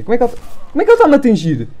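fast, rising high disbelief; frantic exasperation. Real, not acted. Gamer asks how the enemy keeps hitting him, frantic. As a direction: rattle it off in frantic outrage.

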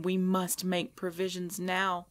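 normal talking voice

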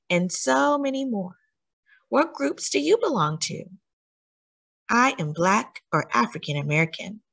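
put-on gentle picture-book read-aloud with questions to the child; a touch of storyteller warmth, mostly natural voice; reading to children. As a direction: read it like bedtime story time for a small listener.

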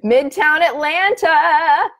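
loud, high, drawn-out cheer; excited shout-out. Real, not acted. Host hails a viewer's city, excited shout-out. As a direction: call it out slow and high, thrilled.

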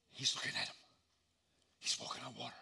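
hushed, breathy awe; whisper. A narrator's reading. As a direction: whisper it like a marvel. Awed hush in the story.

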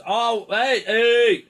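high, loud alarmed brain voice; the brain yelling in alarm when the playing starts; voice of the panicking brain. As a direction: play the brain hitting the panic button. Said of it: "the brain goes".

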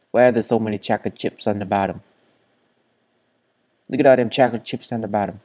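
rapid, loud tumbling words; fast excited. Real, not acted. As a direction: rattle it off excitedly.

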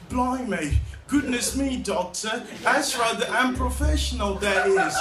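put-on high, flustered British accent; prim British protest, played for laughs; scared British. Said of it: "I turn British when I'm scared".

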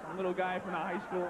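ordinary talk, nothing marked

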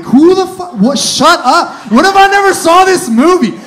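high, loud furious shouting; outraged yell. Retold or imitated. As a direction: blow up at the spoiler. Outraged at spoiler.

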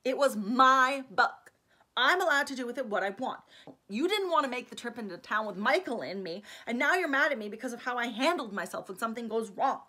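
loud, heated and defiant, slowed on key words; angry outburst. Acted. Angry Alex loudly defending herself to Stefan. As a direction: let her temper roar out. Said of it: "Her temper was roaring to life".

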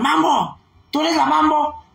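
llamado alegre en la calle, más alto y agudo; grito imitado. Retold or imitated. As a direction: imita a la gente que la reconoce y le grita. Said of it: "me gritan". (grito de fan en la calle, imitado)

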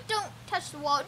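usual speaking voice

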